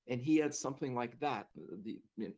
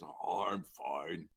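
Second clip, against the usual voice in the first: low, very slow sigh of recovery; gravelly patient, unbothered; deep growly voice; 8 semitones lower, much slower than usual. Retold or imitated. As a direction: drawl it deep, shrugging off the scare. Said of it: "deep growly voice".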